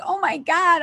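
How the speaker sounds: high tearful outburst, voice breaking; overcome, crying out with a tight high voice. Retold or imitated. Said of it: "I started crying".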